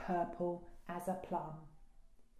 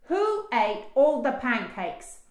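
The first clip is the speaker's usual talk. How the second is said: loud, raised-pitch cross dad; yelling; 5 semitones higher, 9 dB louder than usual. A narrator's reading. Dad finds the pancakes gone, yelling. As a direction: demand the culprit with a yell. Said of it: "yelled Dad".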